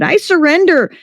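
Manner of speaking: high-pitched cry of surrender; shouted surrender. A narrator's reading. Mover gives up to the maid with the vacuum, shouting high. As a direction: pitch leaps up, no real extra volume. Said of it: "placed his hands in the air and shouted".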